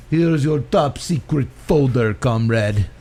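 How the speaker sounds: hammy bad Russian accent, slow; fake Russian accent. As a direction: ein schlechter falscher russischer Akzent. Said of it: "terrible fake Russian accent".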